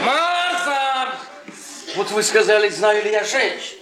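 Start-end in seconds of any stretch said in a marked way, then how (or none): 0.0-1.2 зов наверх, громко и высоко; кричит жене наверх; calling Marta. Acted. Calling upstairs to wife. (громкий зов Марты)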